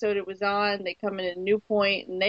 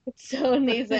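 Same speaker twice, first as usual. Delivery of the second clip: ordinary talk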